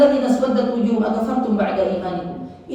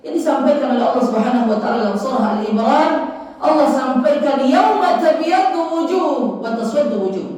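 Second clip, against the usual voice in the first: raised voice building to the verse; sermon voice climbing in pitch; impassioned preaching; 6 semitones higher, 6 dB louder than usual. Acted.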